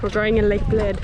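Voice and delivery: excited loud announcement. Real, not acted. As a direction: call it out to everyone. Announcing the swim, shouting.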